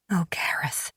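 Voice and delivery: soft whispered sorrow; sad whisper. A narrator's reading. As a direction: breathe it out with sadness. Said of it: "her voice laden with sadness".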